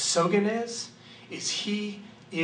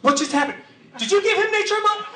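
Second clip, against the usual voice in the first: high excited Tim; 7 dB louder than usual. Retold or imitated. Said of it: "And Tim goes".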